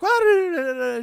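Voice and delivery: high, loud nonsense grumbling as the wife; mad stammering imitation. Wife shuffles away all mad, imitated high stammering. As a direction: babble crossly in a high mock voice. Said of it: "stammers away".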